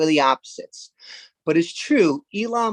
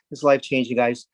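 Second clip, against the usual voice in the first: normal talking voice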